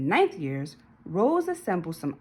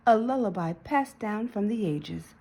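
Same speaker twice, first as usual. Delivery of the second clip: plain reading voice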